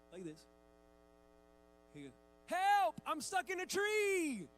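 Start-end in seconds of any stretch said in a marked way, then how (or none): 2.5-4.5 high, loud drawn-out mock holler; child's cry for help. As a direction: imitate a little boy yelling to be rescued. Acting out son's game.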